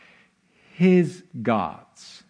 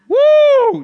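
a big, high, loud "woo" of delight; loud excited whoop; 10 dB louder than usual. Real, not acted. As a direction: shout your joy at the victory. Preacher cheers David's words.

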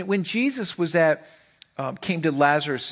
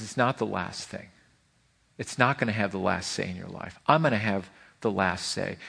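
tender voice of God; 8 semitones lower, 5 dB quieter than usual. Pretend. Voicing God, quieter.

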